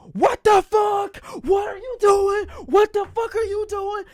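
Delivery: very high, loud mock screech; outraged sisters' shriek. Imitating sisters who walk in on him, shrieking. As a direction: screech it in shocked outrage, stretched out. Sisters catch the brothers watching.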